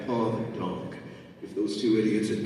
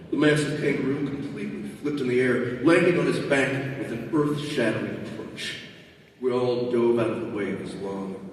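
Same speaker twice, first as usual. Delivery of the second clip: ordinary delivery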